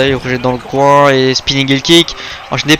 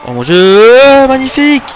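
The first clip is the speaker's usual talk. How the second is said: very high, loud, drawn-out amazement; amazed shout; 12 semitones higher, 7 dB louder, slower than usual. Real, not acted. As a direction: gasp it out in awe. Commentator reacting to a big throw.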